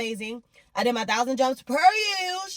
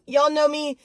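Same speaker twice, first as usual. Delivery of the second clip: ordinary delivery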